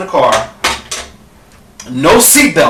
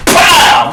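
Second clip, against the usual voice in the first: punch sound; 11 semitones higher, 4 dB louder than usual. Retold or imitated. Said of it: "That motherfucker said".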